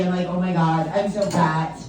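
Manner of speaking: ordinary talk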